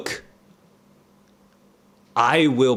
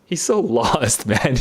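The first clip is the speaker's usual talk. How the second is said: usual speaking voice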